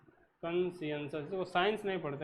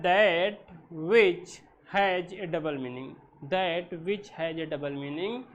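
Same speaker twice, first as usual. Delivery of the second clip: slow, spaced-out definition; slow dictation; 4 dB louder, much slower than usual. Shown as a demonstration. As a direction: read it slowly so they can write. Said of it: "teacher dictating definition slowly".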